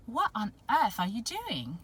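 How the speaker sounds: lightly put-on English accent; mock English accent, incredulous. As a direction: ask it like a baffled English prince. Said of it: "had an English accent".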